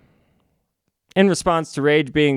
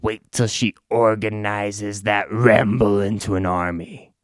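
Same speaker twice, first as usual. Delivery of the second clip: evil man voice; 5 semitones lower than usual. Acted. Evil man warning the band, low and sinister. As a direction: menace them in a lower voice. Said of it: "The evil man says".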